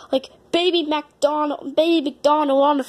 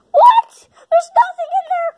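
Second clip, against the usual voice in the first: loud, very high shriek of disbelief; shocked disbelief; 11 semitones higher, 5 dB louder than usual. Acted. Character finding the egg empty, shocked. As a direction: squawk in outraged surprise.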